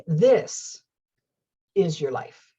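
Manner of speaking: "this" hit hard, then a pause before "is your life"; stress on this. Shown as a demonstration. Trying emphasis on different words, stress on "this". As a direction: punch "this", let the rest drop away. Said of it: "put the emphasis on different words".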